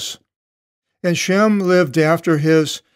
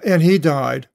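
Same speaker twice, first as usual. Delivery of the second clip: ordinary talk, nothing marked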